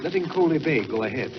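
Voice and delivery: plain reading voice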